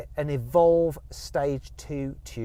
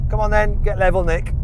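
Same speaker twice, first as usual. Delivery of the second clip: loud, higher call to another driver; 6 semitones higher, 8 dB louder than usual. Real, not acted. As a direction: call across to the other car. Calls to rival driver before race.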